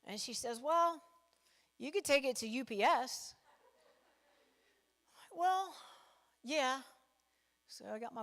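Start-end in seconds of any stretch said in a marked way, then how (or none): 0.6-3.3 raised, louder mock sister voice; sister's voice. Comic retelling of the sister suggesting UPS, voice raised. 5.3-6.8 raised, louder mock sister voice; sister's voice. Comic retelling of the sister suggesting UPS, voice raised.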